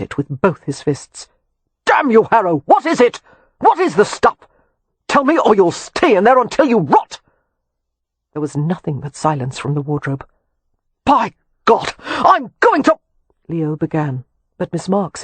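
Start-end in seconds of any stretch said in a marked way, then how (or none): 1.9-7.2 high, loud enraged yelling; furious shout. A narrator's reading. Leo screaming threats at Harrow in the wardrobe, furious shout. 11.0-13.0 high, loud threat breaking off; furious shout. A narrator's reading. Leo threatening Harrow before being interrupted, furious shout.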